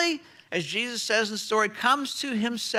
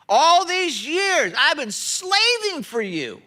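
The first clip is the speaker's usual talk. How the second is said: slow, louder, resentful complaint; outraged brother; 2 semitones higher, 3 dB louder than usual. Retold or imitated. Older brother to father, outraged and slow. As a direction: lean on each word like an aggrieved son. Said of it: "You hear his outrage?".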